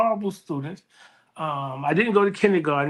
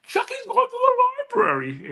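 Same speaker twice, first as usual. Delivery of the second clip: teasing sing-song. Retold or imitated. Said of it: "And I was like".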